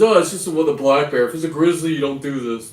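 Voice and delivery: mock the know-it-all friend; brushing off the bear danger to him; imitating buddy. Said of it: "He goes".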